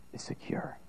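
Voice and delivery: soft, hushed whisper. Real, not acted. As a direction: drop to a whisper for the key truth. Quiet climax of sermon.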